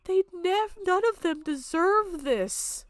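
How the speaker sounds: high, strained, tearful pleading; breaking into tears. Real, not acted. A survivor pleading for victims, crying. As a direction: voice cracking as the tears come. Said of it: "stop crying".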